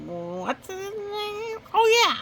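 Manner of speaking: high, slow mock pondering then sudden recall; pretend forgetting. Goose faking forgetfulness in his story, high then bursting loud. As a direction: fake a lapse then pop up high.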